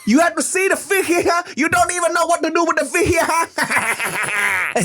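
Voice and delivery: put-on shrieky high Vietnamese-accented yapping; crazy Vietnamese uncle. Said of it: "keeps just yap, yap, yapping".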